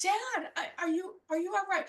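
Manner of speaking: put-on child sees bandaged dad, speaks anxiously near tears; worried, near tears. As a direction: choke up with concern for dad.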